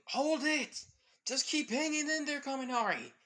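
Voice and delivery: high, loud shout of encouragement; urgent shout. Acted. Teammate calls across the room to Kaminari, shouting high and loud. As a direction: yell to keep him holding on.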